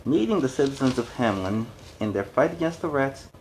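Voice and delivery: ordinary delivery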